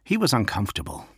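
usual speaking voice

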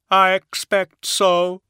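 high, slow hollow voice; drawn out and otherworldly. A narrator's reading. Attias voiced.

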